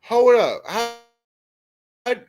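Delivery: high-pitched, startled questioning; incredulous. Real, not acted. Streamer asking a viewer how she already knows, incredulous.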